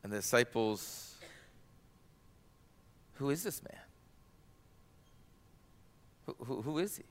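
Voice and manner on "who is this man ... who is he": soft, wondering question; hushed awe. Acted. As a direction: ask it breathless with amazement. Disciples awed after the calm.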